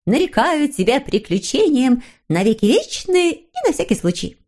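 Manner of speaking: high, lilting ceremonial declaration; proud proclamation. Acted. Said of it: "гордо провозгласила". (гордо и торжественно)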